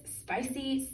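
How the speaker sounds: ordinary delivery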